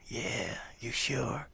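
soft, breathless greeting; imitating Miles's whispered rasp; raspy whisper. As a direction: rasp it barely above air.

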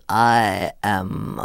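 drawn-out, careful, whispered line; each word weighed, very slowly; menacing slow introduction; very slow and measured. Retold or imitated. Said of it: "said with such measurement".

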